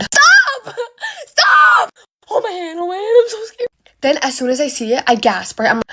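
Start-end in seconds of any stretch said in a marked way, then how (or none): 0.0-3.7 scared. Real, not acted. Storyteller spooked by her own story, frightened squealing. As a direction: squeal in fright, then plead high and small.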